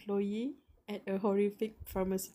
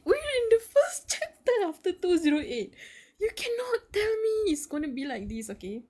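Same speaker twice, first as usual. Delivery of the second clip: panicked protest; 10 semitones higher, 5 dB louder than usual. Real, not acted. Panicking over the chapter.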